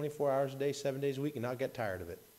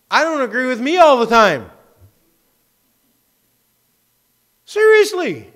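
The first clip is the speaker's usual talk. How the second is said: much higher and louder, calling out to the congregation; preaching shout; 7 semitones higher, 11 dB louder than usual. Real, not acted. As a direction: preach it at full force. Preaching builds to a full shout.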